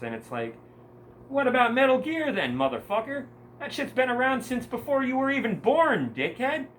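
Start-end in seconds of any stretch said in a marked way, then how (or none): 1.3-6.7 high, loud outburst of angry disbelief; angry rant. Real, not acted. Ranting at the YouTuber who wants politics kept out of games, angry shout. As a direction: yell it at him, pitched way up.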